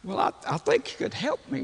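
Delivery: high, small imitated quiver; quivering plea. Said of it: "there's a quiver in his voice".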